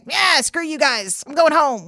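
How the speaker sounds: put-on stomp off like a kid in a huff; childish huff. Acting out own childish outburst.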